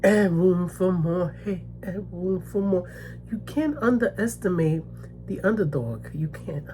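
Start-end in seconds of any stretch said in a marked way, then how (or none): 6.1-6.6 whispered. Real, not acted. Warning viewers not to underestimate her, whispered. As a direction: whisper it as a final point. Whispered emphasis after statement.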